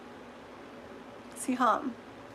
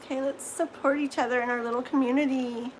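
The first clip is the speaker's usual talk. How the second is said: ordinary delivery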